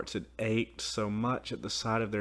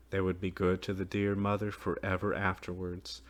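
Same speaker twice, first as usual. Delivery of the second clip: ordinary delivery, nothing marked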